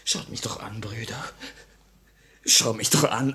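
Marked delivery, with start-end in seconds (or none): 0.1-1.2 hushed, broken plea to the brothers; begging comrades under his breath, barely voiced; pleading whisper. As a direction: whisper it as if ashamed to look up.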